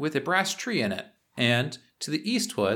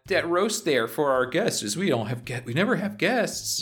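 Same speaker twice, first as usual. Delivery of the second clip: high-pitched, hesitant underling voice; Marty, timid henchman; 11 semitones higher than usual. Acted. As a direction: play a flustered, higher-voiced sidekick.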